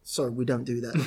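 low, soft mock refusal; lightly voiced agent turning down a caller; dismissive agent. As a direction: drop low and quiet to brush them off. Plays the agent refusing him.

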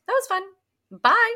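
high, light, carefree dismissal; breezy high brush-off. Retold or imitated. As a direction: toss it off sweetly and leave. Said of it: "She was like".

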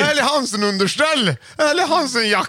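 high-pitched game-show guesses; excited guessing. Real, not acted. Co-host guessing the rhymed gift, excited high voice. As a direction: fire off guesses, getting carried away.

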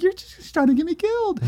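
high, pinched voice of outraged disbelief; mock protest. Protesting to the agent, a high mock-indignant squeak. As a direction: squeak it out like you can't believe her.

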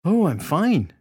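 high, swooping voice with a smile; smiling, tipsy woman. A narrator's reading. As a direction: coo it through a tipsy smile. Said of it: "she said, smiling".

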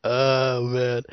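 usual speaking voice

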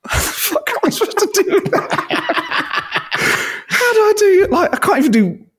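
very high, squeaky laughing despair; exasperated panic. Acted. Voice actor faced with two accents at once, panicked squeal. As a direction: squeal it in mock panic, cracking up.

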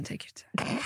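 soft teasing whisper. Real, not acted. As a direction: whisper it playfully.

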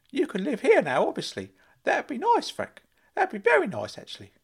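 high, loud eager uncle; Uncle Claude calling out. Acted. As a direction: squeal his name like a giddy fussing uncle. Uncle Claude voiced by narrator.

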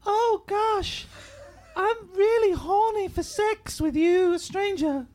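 high put-on girly voice; man doing a woman's voice. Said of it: "Can anyone do a really good girl's voice?".

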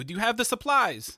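ordinary talk, nothing marked

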